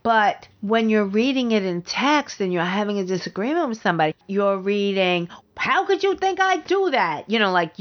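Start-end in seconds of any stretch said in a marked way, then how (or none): 5.6-7.3 high-pitched mock outrage; harsh negative intonation. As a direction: read it as a harsh accusation, pitched way up. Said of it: "make it sound very harsh to you".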